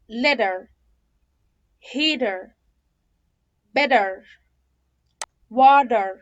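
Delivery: "letter", "better", "water" with D for T; American flapped T. Shown as a demonstration. Teacher drilling T plus R examples, flapped T. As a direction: say letter as "ledder", each word separate. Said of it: "فده كده صوت ال T".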